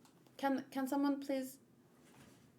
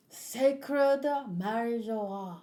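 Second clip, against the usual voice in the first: grand announcement; 4 dB louder, much slower than usual. Acted. Reading the grand place name.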